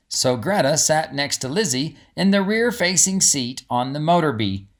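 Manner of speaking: plain reading voice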